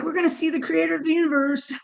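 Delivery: high, quick, giddy retold chorus; giddy excitement. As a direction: voice giggly little cells bursting with news. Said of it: "they're just giggling and giggling".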